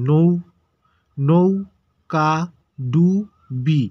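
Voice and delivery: নৌকা ডুবি in isolated, very slow syllables with long gaps; broken into syllables. Shown as a demonstration. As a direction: pause after each syllable of নৌকা ডুবি. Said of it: "ভেঙে ভেঙে উচ্চারণ করতেছি".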